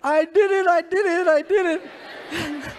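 very loud, high mock wail, last words drawn out; cracking confession. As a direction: cave in like a spanked child, hammed-up howl. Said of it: "we all crack under torture after a while".

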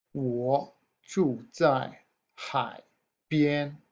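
我住在海边 spoken very slowly, syllable by syllable; slow model sentence. Shown as a demonstration. Teacher modelling 我住在海边 very slowly for students. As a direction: stretch out each syllable of 我住在海边 for learners. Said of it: "models the sentence slowly".

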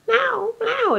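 very high put-on tyrant voice; villain voice. Retelling the Twin Warriors tyrant's voice, high and odd. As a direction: squeak it out like the movie tyrant. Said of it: "His voice was like".

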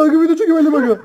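high, loud frantic begging; panicked pleading. Real, not acted. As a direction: beg them to stop, panicking. Pleading being chased in game. (panik içinde yalvarış)

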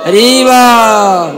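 long loud drawn-out call. Real, not acted. Preacher calling the crowd to shout Hari bol. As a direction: stretch হরি বল out loud to the crowd.

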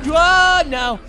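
a jolt of alarm bursting out; sudden loud oh. Real, not acted.

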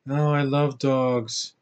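slow, soft, vacant monotone; mocking a wooden actress, slowly; emotionless actress impression. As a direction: drone it out with no emotion at all. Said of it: "she's like that. She doesn't have an ounce of emotion".

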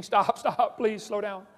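put-on loud, laughing plea of a scared kid; half-laughing, act out kids begging to stop; laughing mock scream. Kids on a spinning merry-go-round begging, loud mock scream. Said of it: "But now they're screaming".

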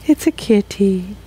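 soft cat voice. Pretend. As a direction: murmur it to the kitty. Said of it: "Again, cat voice".

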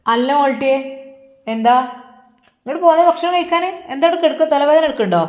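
a father calling his daughter, loud and worried. Acted. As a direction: call her name loud across the room. Father calls worried to daughter's room.

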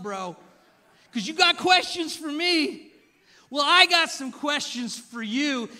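slow, loud, high challenging shout; spicy God shout. Retold or imitated. God challenging Job to brace himself, shouting. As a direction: call him out, slow and booming. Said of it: "it gets spicy".

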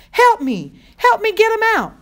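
loud, high cry for help; frantic plea. A narrator's reading. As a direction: yell desperately for a helping hand. Screaming girl begs boy's help.

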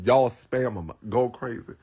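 ordinary talk

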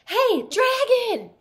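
high, very loud, slow yell; loud shout. Acted. Elizabeth yells right into the sleeping dragon's ear, loud and high. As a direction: bellow it into his ear. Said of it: "She shouted as loud as she could".

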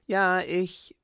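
put-on eager child pleading yes, me; eager asking. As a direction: grab the chance for a lemonade. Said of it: "bat auch Klein Annemarie mit begehrlichen Augen".

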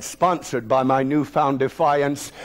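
ordinary delivery, nothing marked

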